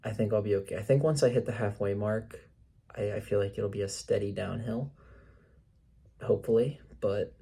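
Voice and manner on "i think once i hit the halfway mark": normal talking voice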